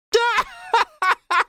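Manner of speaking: high wailing laughter near tears; uncontrollable laugh. A narrator's reading. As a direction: laugh helplessly at her soaking. Heckler bursts out laughing.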